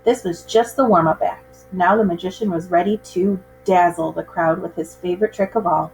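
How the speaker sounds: plain reading voice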